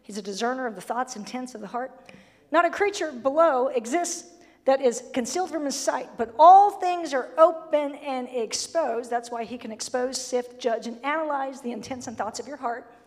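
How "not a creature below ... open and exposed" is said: scripture reading. Acted. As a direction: proclaim the verse.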